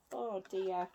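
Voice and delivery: usual speaking voice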